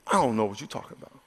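low, put-on gruff denial; cursing denial. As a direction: growl it out like a cornered man swearing. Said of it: "he began to curse".

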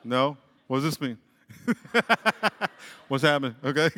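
ordinary talk, nothing marked

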